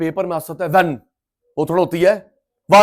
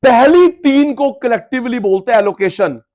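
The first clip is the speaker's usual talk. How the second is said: louder, higher, stressing पहली; emphatic; 6 semitones higher, 8 dB louder than usual. Shown as a demonstration. Teacher loudly stresses the key rule to students. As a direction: drive the rule home loudly. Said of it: "emphatic teaching".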